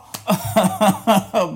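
big amused laugh; loud laugh. Real, not acted. Laughing after own joke.

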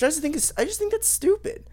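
high-pitched exasperated rant; squeaky exasperation. Real, not acted. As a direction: pitch jumps way up in disbelief. Ranting about the new rule.

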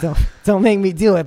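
hammed-up threat; mock threat.

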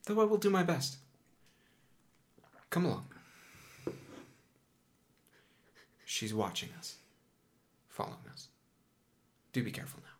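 lightly voiced guardian, hushed and grave; cautious magus. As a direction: trail down to near-whispered warnings as you lead them on. Game master voices the magus guiding players.

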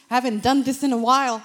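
excited. Retold or imitated. Healed client testing his knees, loud fast joyful exclaim. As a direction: rush it out in joyful disbelief.

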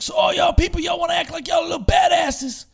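high, loud scornful shouting; ex-prisoner screaming at students acting tough; angry retold yell. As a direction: tear into the room of kids. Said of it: "He's like".

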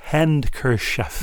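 "Handkerchief" drawn out, slowly, long E on chief. Shown as a demonstration. Host slowly tests his own way of saying it. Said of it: "emphasis on the E sound".

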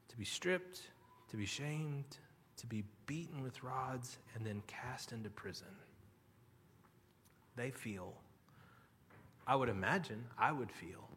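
very soft, low, halting delivery; hushed dismay. Acted. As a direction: drop almost to a whisper, as if abandoned. Voicing the prisoners' doubt and abandonment.

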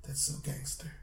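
breathless stunned whisper; awed whisper. Retold or imitated. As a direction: whisper it in disbelief. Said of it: "He's like".

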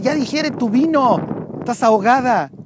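high, slow mocking priest voice; Eli scolding. Priest Eli scolding the praying woman, high and slow. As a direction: drag it out like a scornful old priest. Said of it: "Elí la ve, le dice".

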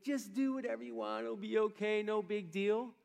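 a hint of an indulgent grandparent; permissive grandparent. Preacher voicing God as a permissive grandparent, breezily lenient. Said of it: "fun, permissive grandparent".

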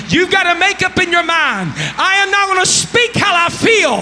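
building to a high, loud, sung-out cry; Spirit-filled preaching. Real, not acted. Preacher in the Holy Ghost, rising from loud speech to sung climax. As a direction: climb until the preaching turns to song. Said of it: "I'm in the Holy Ghost right now".

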